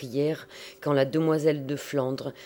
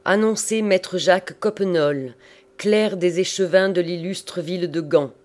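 louder, higher public proclamation; 4 semitones higher, 6 dB louder than usual. A narrator's reading. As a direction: proclaim it for the whole hall. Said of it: "reprit le cardinal à haute voix". (proclamation à haute voix)

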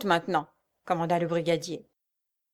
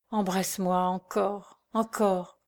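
soft, slow tender voice; tender plea; 4 dB quieter, slower than usual. A narrator's reading. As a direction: hold him close in the words. Mère embrasse son fils. (murmure tendre et lent)

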